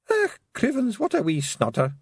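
high, Scottish little pixie muttering; a tiny Scots pixie scoffing. A narrator's reading. Pixie voice from the roof.

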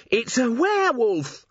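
high, drawn-out alarmed exclamation; high-voiced dwarf in a panic; panicked cry. A narrator's reading. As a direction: cry out in horror at the beast. Panicked dwarf sees a werewolf.

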